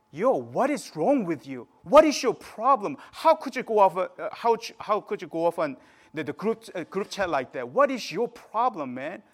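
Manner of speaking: loud, accusing demand with punched questions; acted-out harsh, heated scolding; judgmental confrontation. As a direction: lay into someone like they're in trouble. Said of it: "he's being really judgmental".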